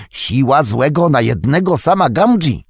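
high, loud, hearty exclamation; excited exclamation. A narrator's reading. Sam marvels at the odds against him, excited exclamation. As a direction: proclaim it loudly, pitched high.

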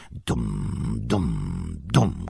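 very low, soft drum booms; thundering drums. A narrator's reading. Narrator voicing drums somewhere below, deep booming imitation. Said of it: "grzmiały gdzieś w dole bębny".

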